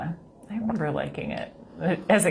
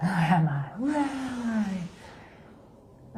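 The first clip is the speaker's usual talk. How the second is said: drawn-out high mystic wail; 4 semitones higher, 3 dB quieter, much slower than usual. Pretend. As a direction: stretch it like an oracle. Mock mystic echo.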